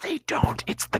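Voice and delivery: quick whispered joke. Real, not acted. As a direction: breathe it with no voice. Deadpan joke on the ending.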